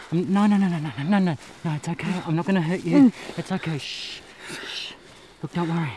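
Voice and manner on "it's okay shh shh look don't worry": usual speaking voice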